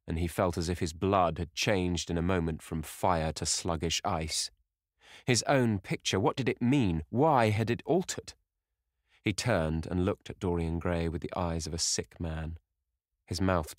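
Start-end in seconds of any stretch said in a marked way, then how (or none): 5.3-8.3 higher-pitched, urgent questions; agitated disbelief. Acted. Painter shocked at finding his own picture altered, agitated disbelief. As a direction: voice lifting in alarmed bewilderment.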